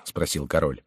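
ordinary delivery

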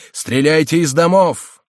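loud, high-pitched order, slowly called out; shouted command. A narrator's reading. Commander ordering his men to fire, shouting. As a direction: holler it across a battle. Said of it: "кричал он".

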